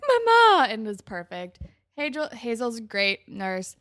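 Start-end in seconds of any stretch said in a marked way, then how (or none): 0.1-0.7 high, loud melodramatic wail; dramatic mama cry. Retold or imitated. Imitating an overacting student on stage, dramatic. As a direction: play it hammed-up and piercing. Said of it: "Margot's dramatic as hell".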